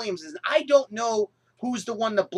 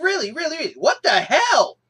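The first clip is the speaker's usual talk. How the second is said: higher and louder disbelief; outraged; 6 semitones higher, 5 dB louder than usual. Real, not acted. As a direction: pile the really's up in outrage.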